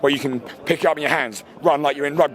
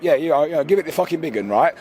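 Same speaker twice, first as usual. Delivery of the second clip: usual speaking voice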